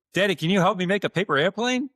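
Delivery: high, lightly voiced child's plea; eager little boy. Young son asking his dad for help, eager child voice. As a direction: ask like a small kid holding out his project. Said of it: "his younger son walked up".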